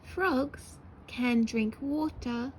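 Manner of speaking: drawn out word by word; slow dictation. Shown as a demonstration. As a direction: dictate it slowly. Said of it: "Let's write".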